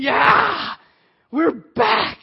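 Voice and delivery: loud, high fan cheer; celebrating yell; cheering shout. Retold or imitated. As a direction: whoop it with the crowd. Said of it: "we're all going".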